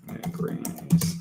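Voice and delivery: "Negrinis" whispered slowly under the breath; whispered to self. Real, not acted. Typing the hire's surname into the search, slow whisper. Repeating name while typing.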